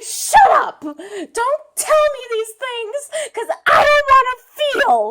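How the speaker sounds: tearful outburst. Acted. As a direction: retort through mock sobs. Acts the sister, tearful.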